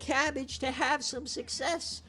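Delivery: high, stretched-out begging; desperate pleading. Real, not acted. Host begging for his team's success, high pleading. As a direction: plead on your knees, drawing the words out.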